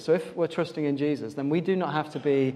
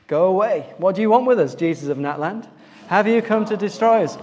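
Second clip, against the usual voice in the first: demon's raised-voice protest; crying out; 3 semitones higher, 5 dB louder than usual. Acted. Demon confronting Jesus in the synagogue, crying out. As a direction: cry out loudly at an intruder. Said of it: "at the top of his voice".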